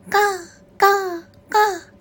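separate, punched crow calls; repeated crow caws. A narrator's reading. Crow singing on after losing food, cawing. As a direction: caw on and on, one call at a time. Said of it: "と歌い続けています".

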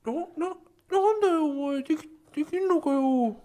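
stammering high voice, nervous taunt; Jiro impression. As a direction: stutter it out in a high voice. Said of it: "二郎のモノマネ".

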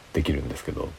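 ordinary delivery

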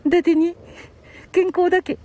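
small, high character voice; teasing puppet. Pretend. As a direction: tease as a little dummy, pitched high. Puppet teases Grandpa.

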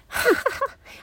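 short high burst of laughter; high amused chuckle; real laugh. Real, not acted. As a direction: laugh at your own odd casting. Amused by own death-god role.

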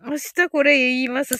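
ordinary talk, nothing marked

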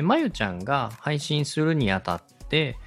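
usual speaking voice

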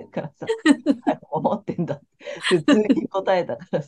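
loud, laughing through the words; laughing while talking. Real, not acted. Host recalling a friend's straight answer, really laughing. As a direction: crack up while telling it.

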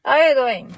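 "How are you doing" said as "ah-ya-doin", no H; dropped H. Shown as a demonstration. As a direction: leave the H off "How", run it together. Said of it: "もう言わずに".

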